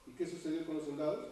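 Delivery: normal talking voice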